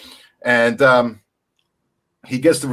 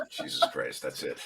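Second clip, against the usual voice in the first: whispered, low and soft to himself; soft unvoiced aside; under-breath mutter; 12 semitones lower, 12 dB quieter than usual. Real, not acted. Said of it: "mutters after being put on spot".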